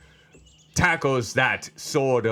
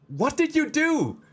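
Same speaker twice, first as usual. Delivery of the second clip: high-pitched, incredulous outburst; acted shock, voice shooting up; astonished question. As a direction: ask it in squeaky amazement.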